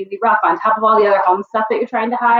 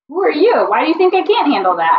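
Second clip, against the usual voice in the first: higher, indignant retort; retold defensive anger; 8 semitones higher, 3 dB louder than usual. Student retelling her comeback to an abrasive professor, indignant and higher. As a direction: snap back at the professor in offended disbelief. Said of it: "I got so mad and so defensive".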